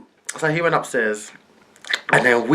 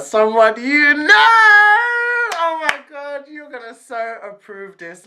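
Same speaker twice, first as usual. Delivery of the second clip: excited teasing; 14 semitones higher, 4 dB louder than usual. Retold or imitated. Girl teasing auntie about the boyfriend's identity, excited.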